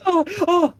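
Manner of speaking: high, hollow "oh, oh" on hitting the floor; little high yelps of impact; cartoonish falling-out-of-bed noise; high-pitched thud yelps.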